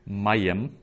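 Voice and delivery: "mayim" with the accent on MA; stress on MA. Shown as a demonstration. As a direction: hit the first syllable of "mayim". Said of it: "the accent is on the ma".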